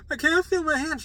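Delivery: high, loud panicked cry; freaking out. Retold or imitated. Patient to nurse as numbness hits, freaking out. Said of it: "I was freaking out".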